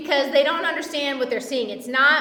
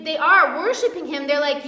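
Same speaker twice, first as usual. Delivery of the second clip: usual speaking voice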